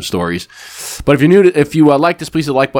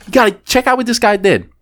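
high-pitched acted excitement; excited showing off; 11 semitones higher than usual. Dealer shows the manager the video, excitedly. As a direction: re-enact eagerly showing someone, pitched up.

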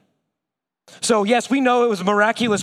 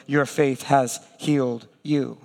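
tender; 5 semitones lower than usual. Retold or imitated. Jesus speaking to the healed woman, tender. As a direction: give her dignity with one gentle word.

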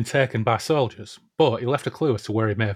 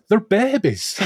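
laughing; 11 semitones higher, 5 dB louder, slower than usual. Real, not acted. Amused at band's age.

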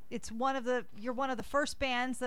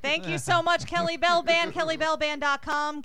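loud, upbeat radio plug; excited shout-out; 5 semitones higher, 7 dB louder than usual. Real, not acted. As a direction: hype the band to listeners. On-air thank-you and band plug.

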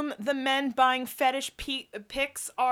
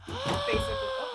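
startled gasp; 14 semitones higher than usual. Real, not acted. Gasps as phone falls.

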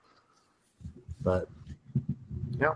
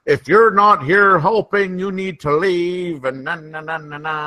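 high, drawn-out nagging rule-giver; slow, singsong mockery in a raised pitch; mock tight-assed scolding; 8 semitones higher, slower than usual. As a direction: imitate an officious nag, slowing the words and tailing off into na-na-na. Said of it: "tight-assed about".

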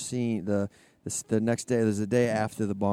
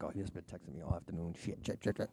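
hushed panicked muttering; panicked whisper; 4 semitones lower, 12 dB quieter than usual. Retold or imitated. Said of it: "I'm like".

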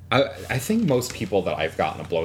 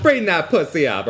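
laughing catchphrase; 9 semitones higher, 6 dB louder than usual. Pretend.

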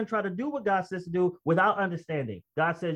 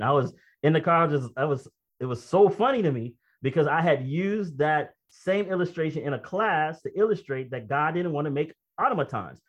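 ordinary talk

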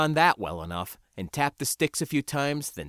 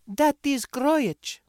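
put-on very high, slow girl's voice; Maylee's character voice; 4 dB louder, much slower than usual. A narrator's reading. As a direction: pitch it way up and draw it out. Narrator voices Maylee pointing the way.

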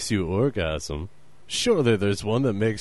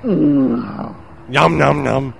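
mock Muppet yum; 3 semitones higher, 6 dB louder, much slower than usual. Continuing Muppet mockery.

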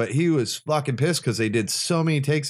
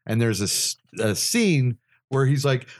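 ordinary delivery

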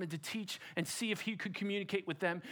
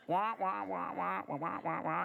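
goose honks. Shown as a demonstration. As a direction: honk like a flock of geese, held back. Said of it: "I can't be too loud".

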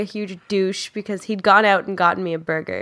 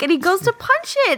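ordinary delivery

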